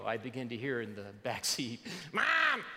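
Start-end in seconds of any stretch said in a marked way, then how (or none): 2.1-2.6 put-on high whiny kid calling; whiny tattling little sibling. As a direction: whine like a child. Kids start again.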